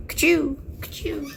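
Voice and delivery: high playful "ka-choo" blaster sounds; camera shot noise. Pretend. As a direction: make the camera go ka-choo twice. Imitating a camera firing.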